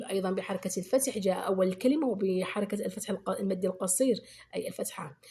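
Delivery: ordinary talk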